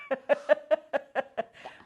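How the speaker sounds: a short, bright burst of laughter. Real, not acted. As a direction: laugh at yourself, caught off guard. Opening laugh on air.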